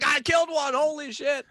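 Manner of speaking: high, loud burst of glee; excited gamer whoop. Retold or imitated. Gamer rolling a twenty, loud excited whoop. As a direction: blurt it out like you just nailed the kill.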